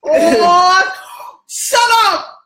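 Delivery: loud, high, laughing protest; delighted mock outrage; playful shut up. Real, not acted. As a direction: squeal it at the friend. Reacting to being teased.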